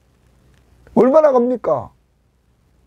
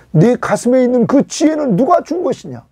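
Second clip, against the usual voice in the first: emphatic challenge; 8 semitones higher, 3 dB louder than usual. Acted. Restating God's question to Job.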